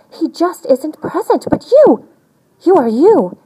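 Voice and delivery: emphatic exclaim. A narrator's reading. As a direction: proclaim it with pride. Emphatic climax of the verse.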